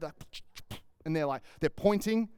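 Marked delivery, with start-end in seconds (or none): 0.2-0.8 whisper. Retold or imitated. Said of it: "'cause they couldn't talk that loud".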